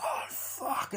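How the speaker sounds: very high, strained exclamation; mock cry of pain. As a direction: yelp as if fingers are being broken. Victim's fingers being broken.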